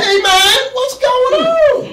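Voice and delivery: very high, crying squeal; wailing high-pitched fellow prisoner; hammed-up panicked guy in the wagon; other prisoner. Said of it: "He said".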